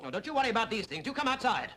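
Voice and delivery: high, heated dare to fight; angry challenge. Acted. Prosecutor inviting defender outside to fight, heated. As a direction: challenge him, pitch climbing.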